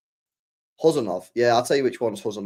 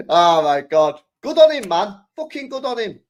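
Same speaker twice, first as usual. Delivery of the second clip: excited praise; 8 semitones higher, 7 dB louder than usual. Real, not acted. Cheering Haaland's anger.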